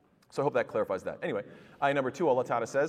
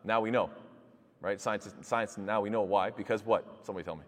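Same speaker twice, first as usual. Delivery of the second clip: ordinary talk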